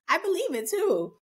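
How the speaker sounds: ordinary talk, nothing marked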